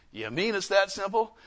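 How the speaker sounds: ordinary talk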